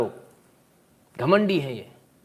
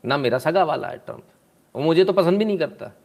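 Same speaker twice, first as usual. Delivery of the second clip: normal talking voice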